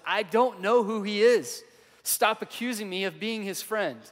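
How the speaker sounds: put-on Peter losing his temper with the girl; angry outburst. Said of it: "in anger and rage".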